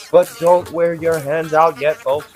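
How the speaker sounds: ordinary talk, nothing marked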